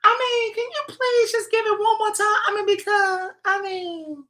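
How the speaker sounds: high falsetto pleading voice; imitating Lori begging for the recipe; mock Lori Jeter. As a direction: squeak the plea way up high. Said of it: "She sounded exactly like that".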